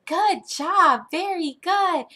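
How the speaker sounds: high, sing-song teacher praise; upbeat classroom encouragement; excited praise. Shown as a demonstration. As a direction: cheer on a little pupil brightly, pitch swooping. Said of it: "they get so excited and say".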